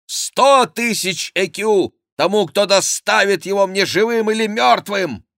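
high, loud, impassioned proclamation; furious demand. A narrator's reading. King Henri offers reward for the culprit, raging high cries. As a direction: proclaim the bounty in a rage.

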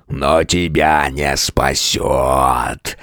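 deep, slow, snarling beast; angry monster voice. A narrator's reading. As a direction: growl it low and slow like a monster. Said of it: "послышался злобный голос".

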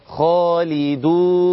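خالدون recited slowly with the long waw held; stretched vowel. Shown as a demonstration. Teacher reciting an example of waw madd, stretched. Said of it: "اسمع كده".

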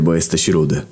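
ordinary delivery

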